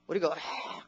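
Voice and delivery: usual speaking voice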